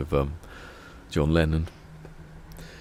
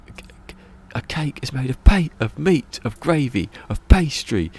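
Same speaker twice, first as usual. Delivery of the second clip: higher, louder stammering bluster; flustered driver; 9 semitones higher, 6 dB louder than usual. Retold or imitated. The taxi driver flustered explaining pies, stammering. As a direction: blurt it out, tripping over words.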